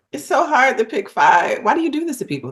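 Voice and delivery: high-pitched, playful complaint; playful anguished protest. Real, not acted. Singer moaning to host about picking five, mock-tormented. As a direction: protest as if it's sheer agony.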